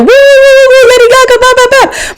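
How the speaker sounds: mock cheering. Mocking her own pride posts to the host, high playful whoops.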